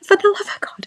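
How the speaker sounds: high strained whisper; whispered despair. Retold or imitated. Mother in labour to the nurse, high strained whisper. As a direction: breathe it out in mock agony. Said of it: "I was like".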